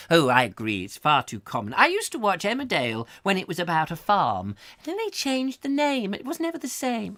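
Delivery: put-on high prim old lady voice; high, prim neighbourly gossip. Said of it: "I've got to get out of character".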